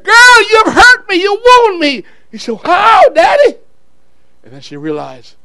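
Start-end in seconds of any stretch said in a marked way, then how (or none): 0.0-2.0 loud, high, hammed-up wail; acted tearful outcry, pitched high; crying father. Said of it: "he cried his head off". 2.6-3.6 put-on high, loud child's question; acted daughter, high and rising; little girl asking. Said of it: "She said".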